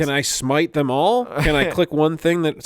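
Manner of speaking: a hint of Satan puzzling over an iPad; tech-baffled Satan. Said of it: "I'm just picturing Satan with eyeglasses".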